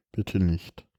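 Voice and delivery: a soft, pleading repeat; hushed plea. Acted. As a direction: breathe it out as a quiet prayer. Repeated pleading thought, near whisper.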